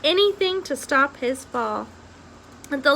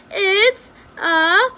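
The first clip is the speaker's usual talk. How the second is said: very slow, high, loud scream; 13 semitones higher, 6 dB louder, much slower than usual. A narrator's reading. Princess screaming as the rat flees, long high shriek. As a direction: drag the shriek out in terror. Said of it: "she screamed".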